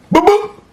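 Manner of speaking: very high, loud double boop; imitated punch noises. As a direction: pop out two squeaky hit sounds. Imitates the two hits.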